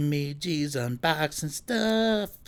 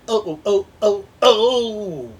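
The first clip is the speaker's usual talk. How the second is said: excited yelps; 6 semitones higher, 5 dB louder than usual. Pretend.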